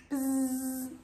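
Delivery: a quiet bee buzz; soft buzzing bee. Pretend. Host imitates the bees Pili likes, soft buzz.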